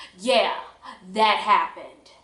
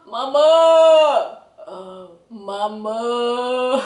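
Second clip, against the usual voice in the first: panicked call; 8 dB louder, much slower than usual. Retold or imitated. Lost teen on a bike panics and wails for Mama. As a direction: cry out for Mama, half laughing at herself.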